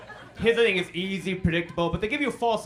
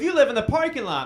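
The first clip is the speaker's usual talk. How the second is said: put-on higher, louder blunt kid voice; second grader mocking her teacher, higher and louder; mock kid's jab; 9 semitones higher, 7 dB louder than usual. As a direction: play the kid's gleeful insult, pitched up and loud. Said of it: "She goes".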